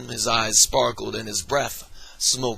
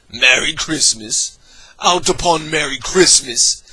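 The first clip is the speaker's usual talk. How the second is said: louder, building scornful tirade; grumpy rant; 3 semitones higher, 5 dB louder than usual. Acted. Scrooge ranting to his nephew about Christmas, grumpy tirade. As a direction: rail against Christmas with contempt.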